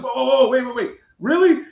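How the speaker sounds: alarmed disbelief. Retold or imitated. Driver hears he's being arrested, high startled protest. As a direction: halt him in startled disbelief.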